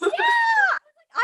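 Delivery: a very high, loud squealing yeah; excited squeal. Real, not acted. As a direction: let out a delighted shriek. Gushing over [name]'s kit.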